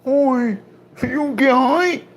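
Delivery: very high squeaky question. Pretend. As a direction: squeak it like a cartoon. Squeaky put-on voice.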